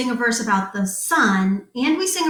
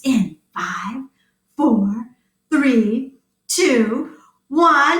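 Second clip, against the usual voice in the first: countdown; much slower than usual. Acted.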